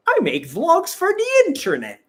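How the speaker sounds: high, loud cartoonish self-imitation; put-on cutesy voice. Traveler answering people who ask why she films, high put-on voice. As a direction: pipe it up high and loud, playfully.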